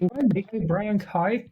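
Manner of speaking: high, slow Northern Irish copy. Shown as a demonstration. Copying friend's drama school phrase, Northern Irish. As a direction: draw out "cow" as "kye" in Northern Irish. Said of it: "for Northern Irish".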